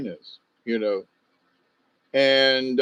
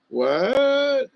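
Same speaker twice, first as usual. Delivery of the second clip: sudden high startled what; mock shock; 11 semitones higher than usual. Retelling swingers' offer, high startled mock surprise. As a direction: jump up in pitch, caught off guard.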